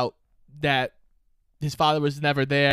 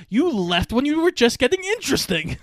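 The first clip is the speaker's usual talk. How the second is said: dry father quip; 8 semitones higher than usual. Retold or imitated. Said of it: "He goes".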